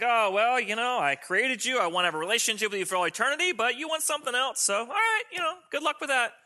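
high, airy shrug of a voice; mock nonchalant God. Preacher acts out a God who wouldn't care, high and mock-casual. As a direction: toss it off high, as if letting it go. Mocks an indifferent God he rejects.

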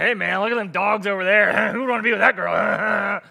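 chummy party-guy voice with a chuckle; overeager buddy. Retold or imitated. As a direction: ham up a guy ogling the dance floor. Buddy ogling girls in retold story.

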